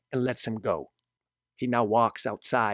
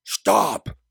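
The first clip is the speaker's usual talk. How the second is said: higher, loud shouted order; screamed command; 5 semitones higher, 7 dB louder than usual. A narrator's reading. Gabriel screams after a fleeing woman, loud command. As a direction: scream it after someone running away. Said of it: "Gabriel screams".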